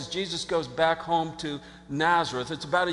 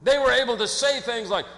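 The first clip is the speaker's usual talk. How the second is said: normal talking voice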